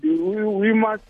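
ordinary delivery

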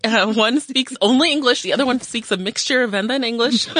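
ordinary talk, nothing marked

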